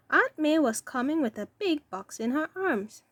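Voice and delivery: ordinary delivery, nothing marked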